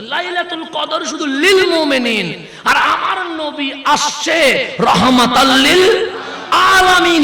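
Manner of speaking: loud, high, drawn-out sermon climax; preaching at full force. Acted. Preacher rousing the congregation about the Prophet, full-force preaching. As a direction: soar up and hammer it to the crowd.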